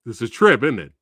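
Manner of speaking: ordinary talk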